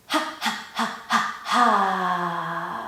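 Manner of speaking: short puffed ha's, then a long held ha; breath exercise ha. Shown as a demonstration. Teacher counts out a breathing drill, puffed ha's. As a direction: puff ha four times, hold and empty out. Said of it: "Your tummy should be bouncing".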